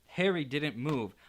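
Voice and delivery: ordinary delivery, nothing marked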